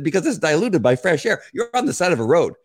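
pressing the point hard, voice climbing; heated explaining. Retold or imitated. Witness lecturing the opposing attorney, worked up. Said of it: "I said".

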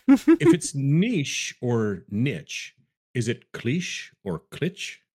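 NICHE slowly as "neesh", then "nitch", CLICHE as "cleesh", CLICH as "clitch"; contrasting pronunciations. Shown as a demonstration. Host challenging co-host's logic, deliberate mispronouncing: neesh, nitch, cleesh, clitch. Teasing with two pronunciations of each.

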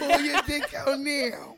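slow, loud hammed-up sexy command; mock sultry demand. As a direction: ham up a sultry order while laughing. Mocking phone sex lines.

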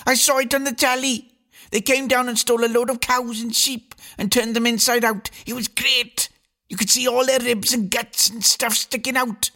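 put-on high, gleeful little boy voice; excited boy. A narrator's reading. Little William tells his sisters about aliens, high and gleeful. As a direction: relish every gory detail like a small boy. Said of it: "screamed William".

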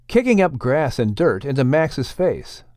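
ordinary delivery

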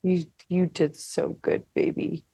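dry, sarcastic praise. Acted. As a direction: say it sarcastically, trailing off. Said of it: "And that was sarcastic".

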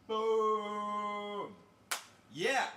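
high excited boom; celebration cry. Real, not acted. Streamer celebrating the high five with viewers, excited.

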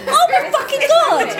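high, laughing disbelief. Real, not acted. Comedian laughing with joy at her new song. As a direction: laugh out your delight. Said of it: "I've written an Emmy-winning musical".

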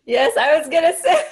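laughing while talking; giggling through it. Real, not acted.

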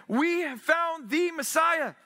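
high, slow joyful announcement; eager proclaiming. Acted. Andrew proclaiming the Messiah, high and slow with eager joy. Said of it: "Andrew's eager example of proclaiming".

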